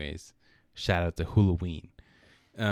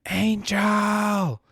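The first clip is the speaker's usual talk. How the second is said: higher, louder call of a name; retold yell; 12 semitones higher, 6 dB louder than usual. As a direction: call the name across the house. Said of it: "Someone yells inside the house".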